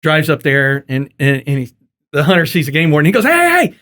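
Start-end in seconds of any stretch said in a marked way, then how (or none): 3.2-3.7 high-pitched, hammed-up hailing; excited mock greeting; eager calling out. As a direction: cut in eagerly as the cocky hunter. Hunter greets warden before accusation.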